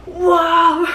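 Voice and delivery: high, loud playful whoa as she leaps; a hammed-up cry of a hero jumping in; mock whoa. Acting Selena jumping through portal.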